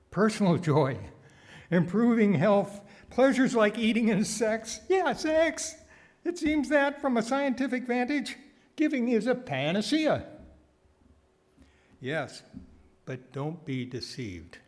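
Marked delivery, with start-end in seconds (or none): 0.1-10.3 louder and higher, gushing over the findings; mock excitement. Preacher hamming up amazement at science for congregation, louder and higher. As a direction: sell it like an amazed salesman.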